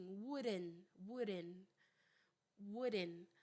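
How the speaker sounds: WOULDN'T as "wurren", ending on N without the T; American tapped pronunciation. Shown as a demonstration. Teacher repeating for students, tapped "wurren" for WOULDN'T. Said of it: "Ele vira um R".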